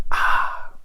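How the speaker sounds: ordinary talk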